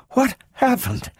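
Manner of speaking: high, faint-voiced question; weak, dazed question. A narrator's reading. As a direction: ask it as if just coming round. Doctor feebly asks after attack.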